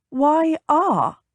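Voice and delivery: high, suspicious challenge. A narrator's reading. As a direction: question him, pitched well up. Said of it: "She frowned suspiciously".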